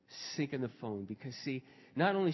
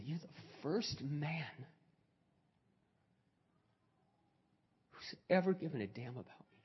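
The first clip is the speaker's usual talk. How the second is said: retold tearful confession; 7 dB quieter than usual. Crying woman thanking the pastor who cared, hushed and halting. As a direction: let it out softly, with a long pause. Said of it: "she's just crying".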